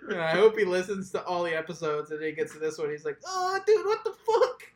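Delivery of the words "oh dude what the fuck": very high cartoon voice; squeaky mock caller. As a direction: squeal in shock, giddy teen. Mocking caller voice.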